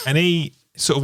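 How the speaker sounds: usual speaking voice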